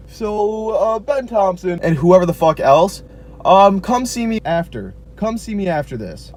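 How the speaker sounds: dean on the overhead. Retold or imitated. Dean of students summoning the dress code offenders, loud mock announcement. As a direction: boom it out like a stern dean on the PA. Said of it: "my dean of students goes on the overhead".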